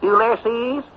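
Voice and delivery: loud, high, questioning holler; calling down the phone. Acted. Storekeeper getting Ulysses back on phone, hollered. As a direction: holler to raise the party on the line.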